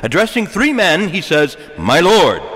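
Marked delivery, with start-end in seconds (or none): 1.8-2.4 "My Lord" louder, weight on "Lord"; "My Lord" said firmly, the word "Lord" stressed; emphatic singular Lord. Shown as a demonstration. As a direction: lean on "Lord" to show it is one.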